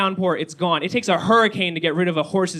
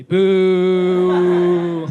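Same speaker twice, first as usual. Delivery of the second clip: loud drawn-out boo; 2 semitones lower, 7 dB louder than usual. Real, not acted. Booing a crowd member from LA, loud long boo.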